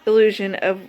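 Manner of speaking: measured, earnest recital of her poem; deliberate spoken-word affirmation; poem reading. Acted. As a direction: read each line like a vow to yourself. Reads her self-love poem.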